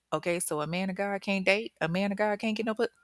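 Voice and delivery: usual speaking voice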